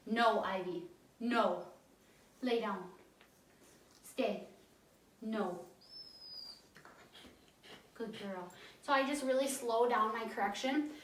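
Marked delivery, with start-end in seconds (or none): 0.1-5.6 low, firm no's to a puppy; firm correction. Shown as a demonstration. Trainer correcting puppy Ivy, firm and low. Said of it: "I'll give her a more firm correction". 7.9-8.5 soft praise. Real, not acted. Trainer rewarding calm puppy, soft and gentle.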